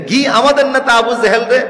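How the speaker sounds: loud, high-pitched indignant outburst; Omar's heated outrage. Retold or imitated. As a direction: thunder a vow of revenge in acted fury. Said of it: "তখন হযরত ওমর গরম হয়ে গেলেন". (গরম হয়ে রাগে গর্জন)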